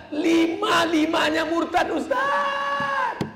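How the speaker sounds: a mother's lament rising to a wail; distraught mother. Acted. Mother telling the preacher her family left Islam, rising shout.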